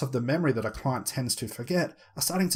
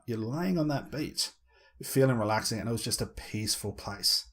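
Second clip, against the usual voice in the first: hypnotic voice; 3 semitones lower than usual. Shown as a demonstration. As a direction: soothe and pace them down. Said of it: "repeat it back in my hypnotic tone".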